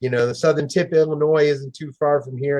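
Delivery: usual speaking voice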